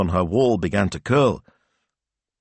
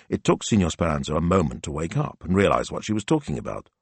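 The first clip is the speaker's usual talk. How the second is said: ordinary delivery, nothing marked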